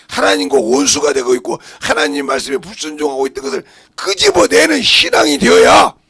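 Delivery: impassioned preacher's climax; full-force preaching. Real, not acted. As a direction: build steadily to a pulpit shout.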